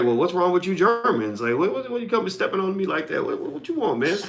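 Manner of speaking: mock indignant comeback. Retorting to German colleague's jab.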